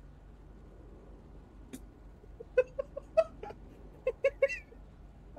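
shrill unhinged cackling; high cackle. Real, not acted. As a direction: cackle high after the taunt. Laughs after taunting police.

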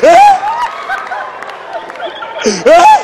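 hammed-up high shriek of disbelief; shocked shriek. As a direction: screech it in shock. Shocked cry.

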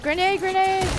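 high urgent shout of grenade; grenade warning yell. Retold or imitated. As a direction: yell the warning to your team. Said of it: "Oh, I'm like".

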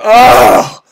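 a loud, high, angry "Oh" forced out; angry groan. Shown as a demonstration. Preacher showing Jesus's vehement groan, loud and angry. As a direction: bark the "Oh" out in sudden anger. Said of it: "like he was angry".

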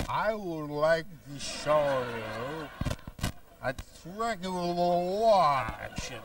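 very slow, drawn out speech; slow motion. Shown as a demonstration. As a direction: stretch every word like slow motion. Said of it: "I'll do the whole thing in slow motion".

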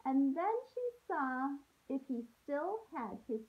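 storybook read-aloud voice for a class; gentle story narration to kids; reading to children. A narrator's reading. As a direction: read it like a teacher sharing a picture book. Teacher reads picture book aloud.

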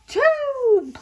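sudden high loud burst; sneeze build-up. Acted. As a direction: let the sneeze explode. Gerald's big sneeze build-up.

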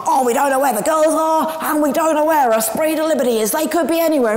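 high, loud radio commentator; lightly hammed-up radio commentator; imitating an excited radio pundit. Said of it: "listening to his commentator was like".

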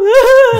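high, loud hammed-up bawl; mock sob. Crying at the rickshaw driver, mock sob. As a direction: imitate your own wailing. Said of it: "And I'd be crying".

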